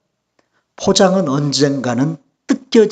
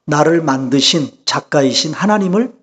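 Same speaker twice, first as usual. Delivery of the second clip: usual speaking voice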